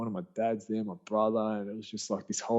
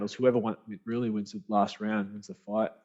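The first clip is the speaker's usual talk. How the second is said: usual speaking voice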